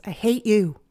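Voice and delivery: loud mock child outburst; child's yell. Child yelling at parent during a meltdown, loud. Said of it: "Your child yells".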